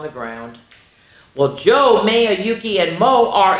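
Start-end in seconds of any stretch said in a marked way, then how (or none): none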